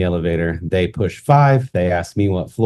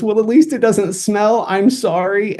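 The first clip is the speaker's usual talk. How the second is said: sweet apologetic mom; 11 semitones higher than usual. Retold or imitated. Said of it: "she goes, she's trying to be sweet".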